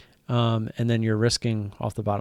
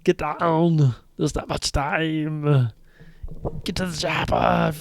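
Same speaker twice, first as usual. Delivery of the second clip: loud, higher-pitched mock action-hero commands; Arnold impression; 6 semitones higher, 5 dB louder, slower than usual. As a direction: bark it out like a movie terminator, hammed up. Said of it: "I'm better with my Arnold impression".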